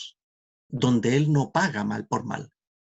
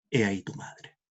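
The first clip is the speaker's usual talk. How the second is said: whispered; 4 semitones lower, 3 dB quieter than usual. A narrator's reading. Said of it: "y le dice".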